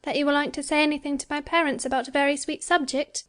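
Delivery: put-on hurried, shy girlish question; faltering. A narrator's reading. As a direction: rush it out bashfully. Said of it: "she said falteringly".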